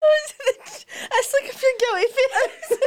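slow, high, laughing through it; barely holding back laughter; giggling. Real, not acted. Podcast host giggling, slow and high.

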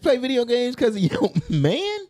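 high, loud, laughing question; laughing disbelief. Real, not acted. Disbelief at the post.

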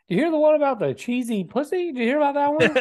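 father-in-law telling joke. Acted. Imagining a father-in-law cornering his daughter-in-law, mock eager joke-telling. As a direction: pitch it up like a pushy joker. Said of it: "imagine, like, talking to your, uh, daughter-in-law".

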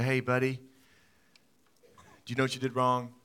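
put-on soft, slow questioning of a child; like a kind dad asking his little boy; gentle parent.

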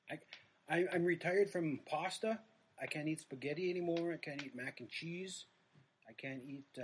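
normal talking voice

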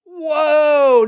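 high, loud stunned whoa. Real, not acted. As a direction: gasp it out in awe. Reacts to seeing the photo.

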